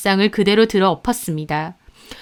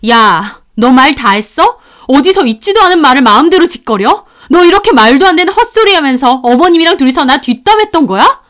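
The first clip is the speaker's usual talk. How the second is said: fuming, raised-voice accusation; furious confrontation; 2 semitones higher, 5 dB louder than usual. Acted. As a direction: burst in and accuse them. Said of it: "꼭지가 돌았습니다".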